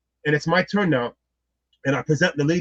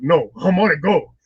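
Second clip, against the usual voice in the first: slow, drawn-out mock protest; agitated; 4 dB louder, slower than usual. Man protesting to the friend comforting him, retold agitation. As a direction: protest with a laugh, retelling your agitation. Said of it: "I was, like, so agitated".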